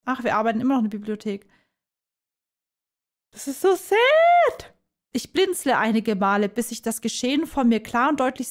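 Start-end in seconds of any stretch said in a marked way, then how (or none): 3.3-4.7 hoch, gedehnt, theatralisch gejammert; übertrieben klagend. Pretend. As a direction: ein langgezogenes, hohes Jammern. Gespielter Klageausruf zur Szene. (hoch und gedehnt gejammert)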